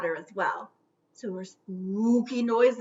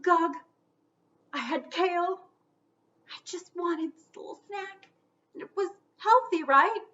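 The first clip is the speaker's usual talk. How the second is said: higher, small confessing voice; guilty ghostling; 7 semitones higher than usual. Acted. First ghostling admitting to Gug it ate kale, higher and pleading. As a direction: own up hoping for mercy.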